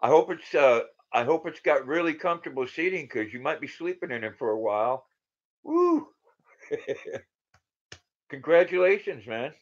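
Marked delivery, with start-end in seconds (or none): 5.6-6.1 excited whoop. Real, not acted. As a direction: let out a happy whoop. Hoots again at truck joke.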